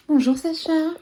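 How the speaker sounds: high, bright sing-song greeting. Real, not acted. Greeting the baby. (voix pour parler à un bébé)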